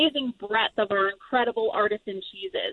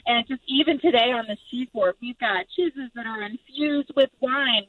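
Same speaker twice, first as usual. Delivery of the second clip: usual speaking voice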